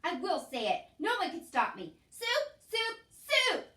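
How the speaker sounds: loud, climbing high shouts; defiant shouting. A narrator's reading.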